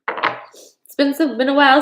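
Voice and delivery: fighting back tears while trying to keep talking; tearful. Real, not acted. Telling viewers she's crying on the show, tearful. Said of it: "since I had some crying".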